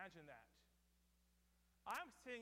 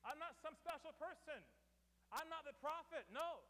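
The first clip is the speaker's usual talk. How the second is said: emphatic denial; 6 semitones higher than usual. Retold or imitated. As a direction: push back, firm and rising. John voiced denying greatness.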